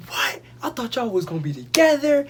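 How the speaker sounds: high, loud imitation of a dismayed mother; exaggerated motherly shock; shocked mom. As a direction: squeal it in mock disbelief.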